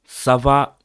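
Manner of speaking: high, slow, booming call to the viewers; big drawn-out YouTube opener; loud greeting. Real, not acted. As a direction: hail the audience loudly, drawing it out. Greeting viewers at video opening.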